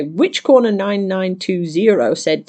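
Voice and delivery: usual speaking voice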